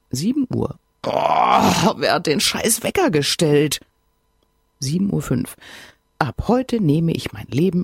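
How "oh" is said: a loud, pained morning groan; groan at alarm. Acted. Groggy woman groans at her alarm clock, pained groan. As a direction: drag the groan out like the alarm hurts.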